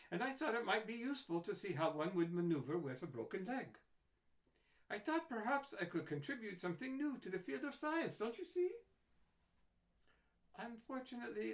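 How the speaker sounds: high, lively eccentric scientist; eccentric doctor. A narrator's reading. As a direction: chatter on, cheerfully odd. Doctor tells her tale.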